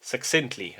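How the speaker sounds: "Succinctly" repeated as suhk-SINT-lee; careful echo of "Succinctly" with k-s in the middle; corrected pronunciation. Shown as a demonstration. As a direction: keep the k before the s in "Succinctly". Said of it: "I thought it was pronounced differently".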